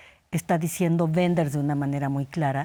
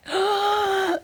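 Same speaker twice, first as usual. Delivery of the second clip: sharp, high drawn-in gasp; acted gasp of sudden lack; startled gasp; 13 semitones higher than usual. Guest acting out the sensation of love, high startled gasp. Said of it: "esta sensación de".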